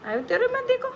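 high coaxing call to a little one. Real, not acted. Calling baby, one take very high.

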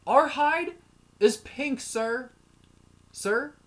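loud high mock lecture to a stranger; ranting. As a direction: call him out from across the room. Ranting at an imagined racist.

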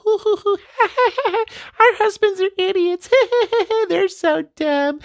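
high, cackling housewife voices; gossiping wives. Acted. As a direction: play the chatty wives, shrill with laughter. Wives voiced at Tupperware party.

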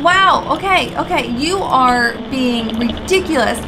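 ordinary delivery

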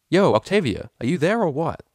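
high, loud call down the phone. Acted. Vinyl calling Octavia on the phone, loud. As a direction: get her attention on the line.

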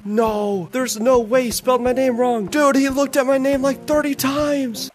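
high, drawn-out disbelief; dismayed whine. Real, not acted. Coach whose recruit spelled his name wrong, dismayed whine. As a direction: wail in protest, stretching the end.

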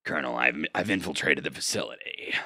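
low growly whispered soldier; Snake voice. Pretend. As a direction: growl a covert radio report. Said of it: "Snake sounds like this".

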